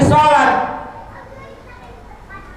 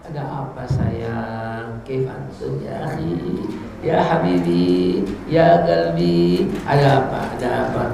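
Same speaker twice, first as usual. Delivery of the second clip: much lower, soft tender coaxing; tender comforting; 12 semitones lower, 4 dB quieter, slower than usual. Retold or imitated. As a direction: gently comfort a sad child. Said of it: "dia lagi sedih nih". (membujuk dengan lembut dan pelan)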